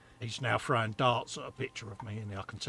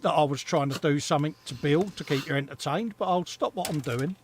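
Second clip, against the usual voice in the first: ordinary delivery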